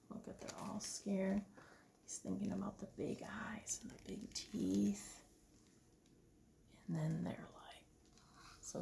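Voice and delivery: soft unvoiced aside; whisper. Real, not acted. Parent whispering to child about scared dinosaurs. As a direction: lean in and whisper.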